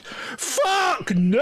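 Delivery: sudden "fuck no" of a driver who won't fit; a jolt of alarm at the sign; mock alarmed outburst. Driver sees a too-low bridge.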